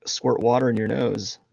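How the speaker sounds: ordinary delivery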